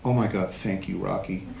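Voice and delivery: soft, low, spent voice; weak, relieved thanks. Retold or imitated. Winded bride thanks the photographer, soft spent voice.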